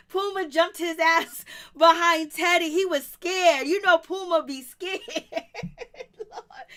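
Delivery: laughing loudly through the words; cracking up at Puma hiding. Real, not acted. Host laughing to co-host about Puma, laughing loud.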